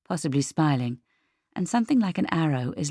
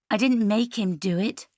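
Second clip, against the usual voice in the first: raised-pitch, mildly heated denial; hot retort; 6 semitones higher than usual. A narrator's reading. Leah defends herself to her sister, mildly hot retort. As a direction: push back with a touch of temper. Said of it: "Leah replied hotly".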